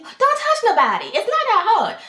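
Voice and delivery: high, loud burst of exasperation; exasperated scolding. Real, not acted. Telling newcomers not to touch anybody, loudly exasperated. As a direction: scold them at full pitch.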